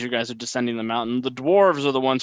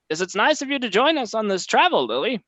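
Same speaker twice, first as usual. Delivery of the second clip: high, chirpy gnome merchant; 9 semitones higher, 3 dB louder than usual. Acted. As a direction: play a cheerful gnome, pitched up. Gnome NPC greeting.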